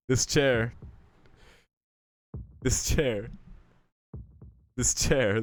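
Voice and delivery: building from even to loud, hammered repeats; obsessive repetition. Acted. Character obsessively repeats one phrase.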